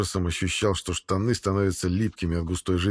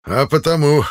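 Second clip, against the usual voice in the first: teasing gatekeeper; 7 semitones higher, 8 dB louder than usual. Acted. Gatekeeper teases the travellers.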